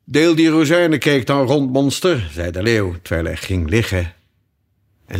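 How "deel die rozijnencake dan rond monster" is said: loud, higher-pitched order; raised-voice command; commanding lion. A narrator's reading. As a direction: boss everyone about as the lion. Lion orders Alice about.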